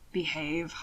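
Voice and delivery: soft warning. Acted. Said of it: "Hannibal warned".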